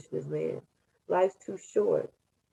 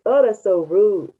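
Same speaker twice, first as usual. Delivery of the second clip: usual speaking voice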